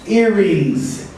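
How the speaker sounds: "earrings" ending in a buzzing z; "earrings", voiced z at the end; z sound. Shown as a demonstration. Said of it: "we have the sound".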